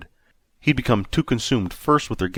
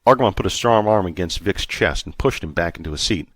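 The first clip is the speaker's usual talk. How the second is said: usual speaking voice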